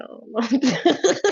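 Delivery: breaking into laughter, rising at the end; cracking up. Real, not acted. Tickled by the liquor remark, cracking up. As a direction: let the laugh take over the last words. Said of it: "I'm cracking up".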